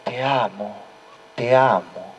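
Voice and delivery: fading quiet echo. A narrator's reading. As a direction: let the echo fade away gently. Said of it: "la voz". (eco suave que se apaga)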